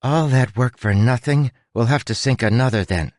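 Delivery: half-hearted line reading; emotionless recital. A narrator's reading. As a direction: recite it with little feeling. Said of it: "could you put less emotion into it".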